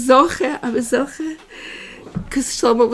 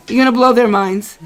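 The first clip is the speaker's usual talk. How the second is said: usual speaking voice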